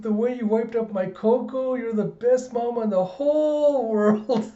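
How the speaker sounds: put-on over-the-top adoring kid; gushing little girl. Child gushing to her mother.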